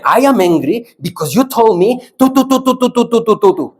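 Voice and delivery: high-pitched hammed-up rant; mock anger. Shown as a demonstration. Teacher showing the group how to rant, mock angry. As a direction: really put it, scolding way up high. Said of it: "Really put it".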